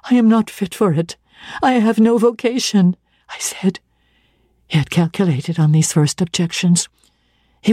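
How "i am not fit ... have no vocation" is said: put-on higher, anxious young woman; protest, a touch higher and pressed; Jane's higher voice. Jane refuses St. John's claim, higher and anxious.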